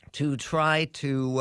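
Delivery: usual speaking voice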